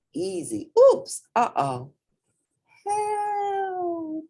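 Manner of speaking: high rising cries of alarm; little hippo about to fall; wobbly panic. A narrator's reading. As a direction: teeter and call for help. Portly wobbling on stilts.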